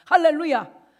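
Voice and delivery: loud, ringing hallelujah; a preacher's loud hallelujah; hallelujah shout. Real, not acted. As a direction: shout it out to the congregation. Preacher cries out to congregation.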